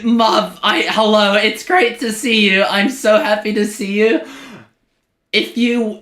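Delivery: ordinary talk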